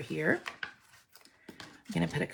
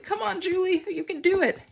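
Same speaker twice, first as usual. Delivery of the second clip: higher, mock cheer of an anxious fan; imitating a nervous viewer urging Julie on; 11 semitones higher than usual. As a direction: egg yourself on as a jittery viewer. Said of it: "Somebody out there is all nervous".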